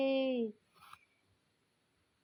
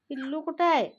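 louder, higher call of पिल्लू कुठं आहे; 5 semitones higher, 6 dB louder, faster than usual. Real, not acted. Owner calling out to the cat for the kitten, louder. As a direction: call out the question, raised.

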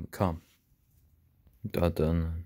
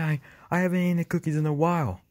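ordinary delivery

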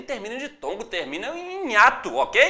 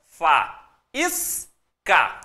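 syllable by syllable; 4 semitones lower, 3 dB louder, much slower than usual. Shown as a demonstration. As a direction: split "fa, is, ca" with a pause after each. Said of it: "Dividir em sílabas". ("fa, is, ca" silabado, com pausas)

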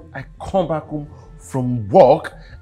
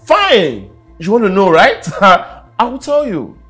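louder, laughing defiance; defiant taunt; 6 semitones higher, 9 dB louder than usual. Acted. Husband about to confess to wife, defiant taunt. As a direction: challenge her with a sneer.

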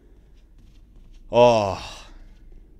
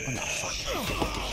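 anguished wail; much slower than usual. Real, not acted. Dying in the game.

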